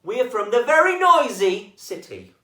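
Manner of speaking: proud noisy fox announcing itself; loud high fox voice. Acted. Fox answers owl.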